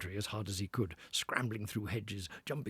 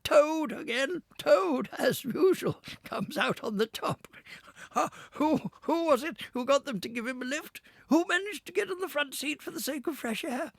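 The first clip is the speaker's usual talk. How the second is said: gloating Toad; 14 semitones higher, 7 dB louder than usual. Acted. Toad boasting to himself.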